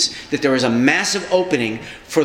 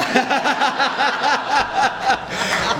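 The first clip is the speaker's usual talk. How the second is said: laughing; 10 semitones higher than usual. Real, not acted. Laughing at surprising union remark.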